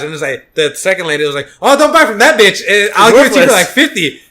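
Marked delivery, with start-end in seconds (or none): 1.6-4.2 high, loud hawker yell; mock vendor shouting. Vendor shouting at a tourist to undercut a rival, loud mock yell. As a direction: yell it like a pushy street vendor.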